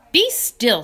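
loud, high "be still"; sharp command. Acted. Charlotte silencing the rat, sharp and loud. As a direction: cut him off hard.

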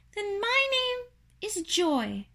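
high, slow tiny voice; little creature, pitched very high; first little spider. Acted. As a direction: announce it slowly in a small squeak.

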